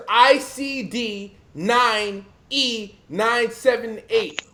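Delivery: slow, letter-by-letter spell-out; slow dictation. Shown as a demonstration. Telling the audience what to Google, spelled out slowly. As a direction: spell the code out slowly for listeners. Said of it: "I want every ... to Google".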